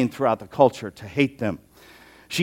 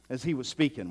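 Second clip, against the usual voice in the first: ordinary talk, nothing marked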